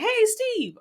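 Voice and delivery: high, loud call to a friend. Pretend. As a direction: hail a buddy across the water. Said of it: "basically like calling, like".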